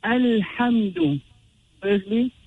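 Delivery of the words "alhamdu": Alhamdu said slowly, each consonant distinct; clear recitation. Shown as a demonstration. Teacher opening the next verse, Alhamdu slow and clear. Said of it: "We move on to our next verse".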